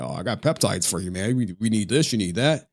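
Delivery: lightly voiced dopey dealer.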